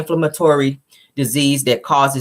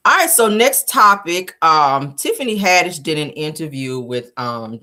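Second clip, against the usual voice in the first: normal talking voice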